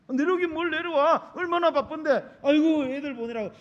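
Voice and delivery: loud, high-pitched scolding refusal; fussy parental protest. Acted. Parent on the phone refusing the child's visit, loud fussy protest. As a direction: brush the offer off with exasperation.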